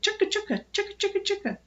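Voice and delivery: high, rapid-fire squirrel chatter. A narrator's reading. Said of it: "Taylor and Filbert said".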